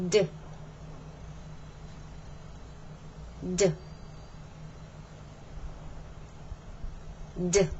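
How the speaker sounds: "D" voiced, with no puff of air. Shown as a demonstration. Teacher drilling students on the voiced d. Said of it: "Let's go on to the D sound".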